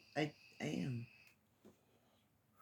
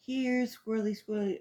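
calling a squirrel; 6 semitones higher, 4 dB louder than usual. Real, not acted.

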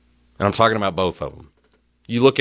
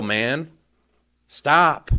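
usual speaking voice

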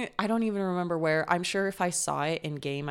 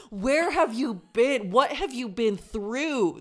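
mock amazement; 5 semitones higher, 5 dB louder, slower than usual. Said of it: "I was like".

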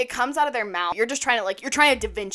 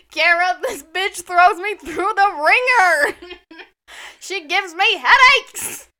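high, loud, slow whine; hammed-up complaint to the mother; mock outraged complaint; 8 semitones higher, 10 dB louder, slower than usual. Telling mom Kara her friend gives headaches, in a loud, high, slow whine.

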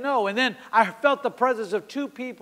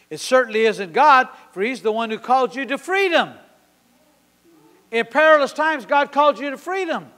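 loud, raised, triumphant preaching; 4 semitones higher, 7 dB louder than usual. Acted. Preacher proclaiming God's call to freedom, loud triumphant preaching. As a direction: proclaim it at full force, climbing higher.

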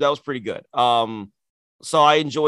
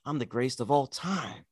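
quiet, brooding champion boast; Roman impression; 10 dB quieter than usual. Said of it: "Roman does the".

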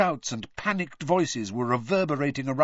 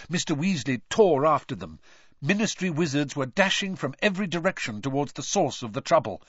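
usual speaking voice

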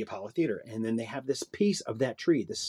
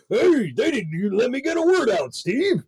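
high indignant complaint; mock protest; 11 semitones higher, 4 dB louder than usual. Performer complaining to Steve, high whine. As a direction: whine to your friend. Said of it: "And I'm like".

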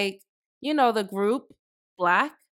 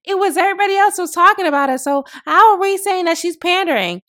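lightly mocked supporters, pitched higher; higher-pitched imitation of protesting voters; imitated defensive supporters; 7 semitones higher, 4 dB louder than usual. Voter defending Kamala Harris over Timbs, imitated defensively. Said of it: "Kamala Harris voters and supporters talking about".

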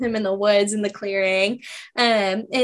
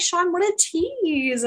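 exasperated drawl; 6 semitones higher, 4 dB quieter, slower than usual. Retold or imitated. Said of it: "I was just like".

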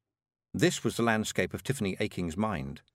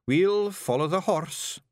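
high, loud wee Scottish fairy; rowdy little Scots pixie voice; broad Scottish accent; 8 semitones higher, 3 dB louder, slower than usual. Acted. Rob decides.

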